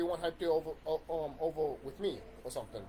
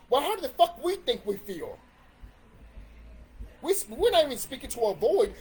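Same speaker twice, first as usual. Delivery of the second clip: raised, louder exasperated voice; higher and louder rant; heated rant; 5 semitones higher, 4 dB louder than usual. Real, not acted. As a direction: vent loudly, fed up. Frustrated rant about being ignored.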